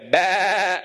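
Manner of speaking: loud, high imitated bleat; sheep bleat. Preacher compares his screaming son to sheep, loud sheep bleat. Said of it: "it's very similar, by the way".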